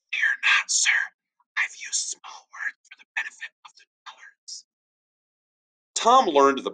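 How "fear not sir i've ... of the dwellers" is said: sly whispered aside. Acted. Tom whispering a jab to his teacher.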